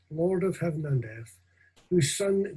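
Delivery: normal talking voice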